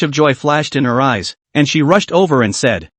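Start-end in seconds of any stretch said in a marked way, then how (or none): none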